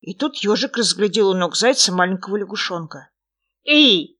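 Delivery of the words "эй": loud, high "hey"; short loud call. A narrator's reading. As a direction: shout to get a friend's attention. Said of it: "крикнул он".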